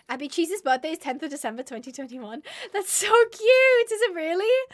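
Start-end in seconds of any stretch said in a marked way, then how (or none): 2.7-4.7 high, loud, stretched squeal; excited squeal. Real, not acted. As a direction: squeal at something adorable. Squealing over birthday fact.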